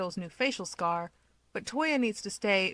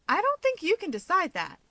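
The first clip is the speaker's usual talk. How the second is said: higher, lightly voiced Keigo, a touch of a frown; 10 semitones higher than usual. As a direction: push back with a slight frown. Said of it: "Keigo frowns".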